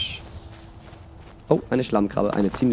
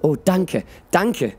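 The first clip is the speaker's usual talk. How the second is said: high, overcome acted gratitude; pitched-up narrated relief; tearful thanks; 8 semitones higher, slower than usual. A narrator's reading. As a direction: give thanks as if near tears. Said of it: "Zinetta brach erneut in Tränen aus".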